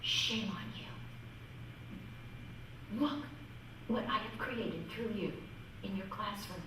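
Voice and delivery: quietly chide, with long pauses; little inner voice. Retold or imitated. The Lord's little voice admonishing a teacher, hushed and grave. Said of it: "this little voice said".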